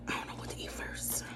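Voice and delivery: barely voiced whisper to herself. Real, not acted. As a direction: breathe it out in a whisper. Musing quietly to herself while eating.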